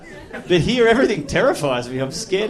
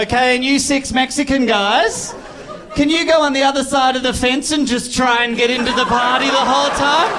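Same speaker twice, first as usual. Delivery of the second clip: high, fake-friendly instruction, pitched up; mock cheery boss; 6 semitones higher, 3 dB louder than usual. Boss directing Mexican guys, mock cheery. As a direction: sweetly send them to the fence. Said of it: "And she can't say".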